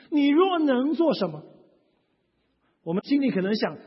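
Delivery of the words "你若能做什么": higher-pitched half-believing question; doubting challenge. Acted. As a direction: put it as a skeptical prayer, pitched up. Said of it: "是不是常常用这种语气呢".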